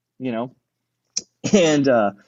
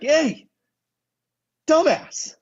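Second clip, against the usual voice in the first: calling out; 13 semitones higher, much slower than usual. Retold or imitated. Calling out a reckless driver.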